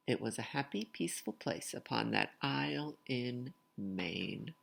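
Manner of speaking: lively, sing-song storytelling voice; picture book read-aloud. Acted. Reading a rhyming picture book aloud to children, lively sing-song. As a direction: bounce the rhymes for young listeners.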